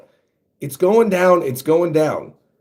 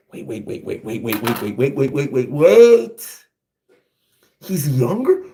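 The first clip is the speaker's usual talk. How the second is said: building disbelief; 3 semitones lower than usual. Real, not acted. Reader stunned by news of the dad, building disbelief. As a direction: start under the breath, build to one big "wait".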